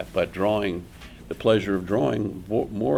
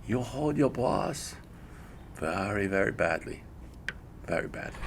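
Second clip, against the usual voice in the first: put-on Japanese accent; 5 dB quieter than usual. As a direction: imitate the teacher scolding in a Japanese accent. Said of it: "imitating an accent".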